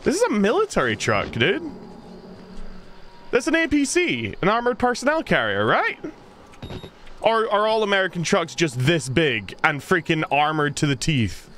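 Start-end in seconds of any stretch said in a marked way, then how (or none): 3.3-6.0 surprised. Real, not acted. Surprised at armored truck.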